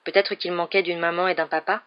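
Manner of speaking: usual speaking voice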